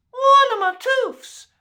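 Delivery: put-on high, loud baby squeal; the baby shrieks it with glee; loud gurgling baby. A narrator's reading. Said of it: "gurgled Baby Rottentot".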